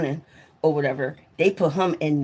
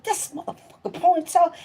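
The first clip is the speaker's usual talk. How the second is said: high-pitched retold outburst; spazzing out; 11 semitones higher than usual. Telling about her snagged ponytail, spazzing out in a high pitch. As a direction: replay your outraged fit in a high voice. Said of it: "I started spazzing out".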